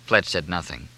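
ordinary delivery

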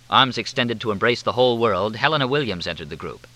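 plain reading voice

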